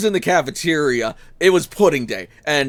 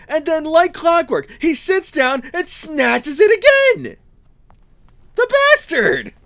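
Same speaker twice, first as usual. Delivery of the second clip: outraged; 9 semitones higher, slower than usual. Acted. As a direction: drag it out, then blow up. Outraged retelling of second theft.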